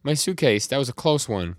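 mock yell, settling as it goes; retold yelling. He spots his suitcase, retold yelling with relief. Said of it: "He starts yelling".

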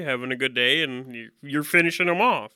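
ordinary talk, nothing marked

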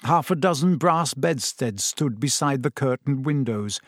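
plain reading voice

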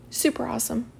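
soft, deadpan praise; unenthusiastic. Shown as a demonstration. Coach repeating the lackluster praise, unexcited. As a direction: say it quietly, as if unimpressed. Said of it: "Can you see how different that is".

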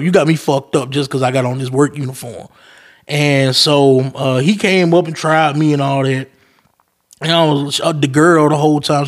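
ordinary talk, nothing marked

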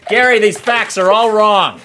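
high, loud hammed-up scolding; mock scolding. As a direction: chew out Gary in mock anger. Scolding fake fact checker.

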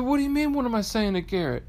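very high, incredulous retort; mock baffled husband. Husband answers wife's odd question, high mock bafflement. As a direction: act out a puzzled comeback to a wife.

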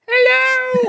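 very high falsetto "Hello"; hammed-up girlish falsetto; fake girl voice. Gangrene villain posing as Buttercup on the phone, falsetto. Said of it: "he's like".

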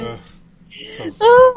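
high wailing mock grief, very loud; mock sadness. Fan mourning a written-out soap character, high mock wail.

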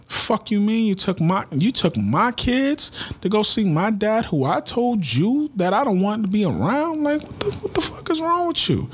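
high, heated retelling of yelling at his wife; incredulous rage at his wife, voice cracking high; retold angry outburst. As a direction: replay the fury, pitch climbing to a near shriek.

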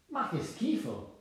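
high, drawn-out cry of disgust; acted squeamish outburst; disgusted exclamation. A narrator's reading. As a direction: exclaim it slowly, revolted, pitch up. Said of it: "esclamò la piccola talpa".

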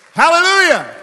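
loud, high ringing hallelujah; joyful preacher shout. Real, not acted. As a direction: lift the room with one cry. Preacher calls out praise.

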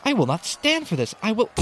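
high-pitched outcry, cut off abruptly; defiant cry. A narrator's reading.

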